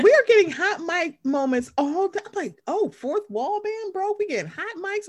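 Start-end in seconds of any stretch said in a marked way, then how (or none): 2.7-5.1 mock amazement. Podcaster mocking producers for leaving hot mics in, mock awe. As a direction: gasp it in fake awe.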